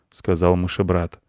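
ordinary delivery

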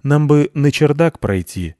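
politely wheedling cat. A narrator's reading. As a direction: ask diplomatically for passage. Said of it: "вежливо сказал кот".

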